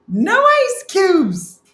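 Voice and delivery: high, very drawn-out mock shout; all-caps shout. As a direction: bellow it slowly like capital letters. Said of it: "Colleen's using all big letters".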